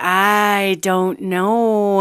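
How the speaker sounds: very drawn-out, uncertain reply; mock-awkward slow stalling; awkward hesitation. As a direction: stretch every word while stalling for an answer.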